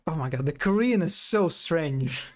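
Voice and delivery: ordinary talk, nothing marked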